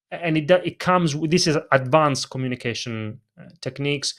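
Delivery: ordinary talk, nothing marked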